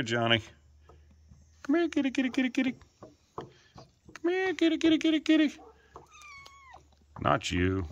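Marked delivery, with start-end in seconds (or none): 1.6-5.5 very high falsetto kitty call; calling a cat. Real, not acted. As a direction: coax the cat over in a squeaky singsong.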